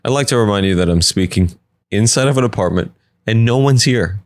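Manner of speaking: ordinary talk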